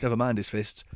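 ordinary talk, nothing marked

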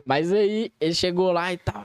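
ordinary talk, nothing marked

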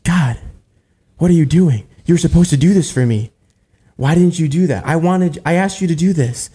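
acted-out exasperated demand; mad at God. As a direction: take God to task, imitating an aggrieved believer. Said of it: "people get mad at God".

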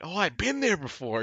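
light up at recognizing the place; excited. Retold or imitated. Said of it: "I get excited because I'm like".

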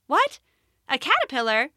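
high, startled "what", then a questioning echo; amazed. Acted. Rabbit seeing a caterpillar leave the cave, amazed. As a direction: react in astonished disbelief. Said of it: "watched in amazement".

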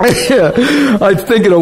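slow, laughing through the words; can't hold back the giggles. Real, not acted. Preacher recalling Wiley, laughing while talking. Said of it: "It was hilarious".

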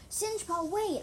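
high, loud urgent call; yelling. A narrator's reading. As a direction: shout after someone running away. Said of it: "I yelled".

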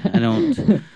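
ordinary talk, nothing marked

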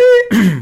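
kräftiges Räuspern mit hoher Stimme. Real, not acted. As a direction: den Hals freiräuspern. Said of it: "meine Stimme versagt langsam". (Räuspern, weil die Stimme versagt)

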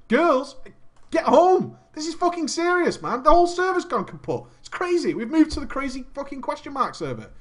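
high-pitched, exasperated urgency; acted-out panicked phone call; frantic pleading. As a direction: insist to a friend that it's an emergency.

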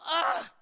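loud, high cry of exasperation; frustrated shout. Retold or imitated. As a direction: let out the anger at the locked door. Mad that the embassy was closed.

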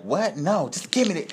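high-pitched mock disbelief; imitating protesting woman. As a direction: object as a suddenly cornered old lady. Retold woman protesting arrest.

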